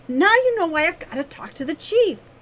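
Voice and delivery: high, loud, urgent demand; insistent. A narrator's reading. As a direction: press him hard to agree. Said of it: "she insisted".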